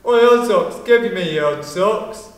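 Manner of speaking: loud imitated bully's call; retold shout. Bully demanding a child's socks, imitated shout. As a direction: hit "Oi, odd socks" hard, then ease slightly. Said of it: "Then he shouted".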